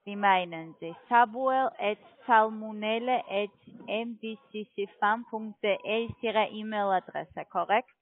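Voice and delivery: ordinary talk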